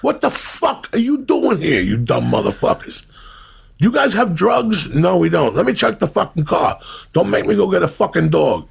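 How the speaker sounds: a hammed-up cop shaking down two kids; imagined angry cop. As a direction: bark it like a furious cop. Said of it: "So if a cop comes by".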